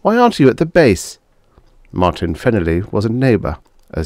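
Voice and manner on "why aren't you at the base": high, shaken question. A narrator's reading. Pete questions his reservist neighbour, shaken. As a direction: ask it loudly, a little rattled. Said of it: "Pete said, shaken".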